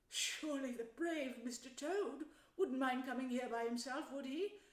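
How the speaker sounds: high little character voice; timid Mole. Acted. Mole asks about Toad.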